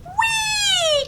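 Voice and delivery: very high, squealing frog "Wee"; frog's happy cry. Acted. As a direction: let the frog squeal with joy. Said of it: "Frog went".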